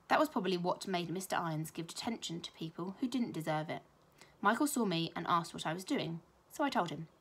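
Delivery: ordinary delivery, nothing marked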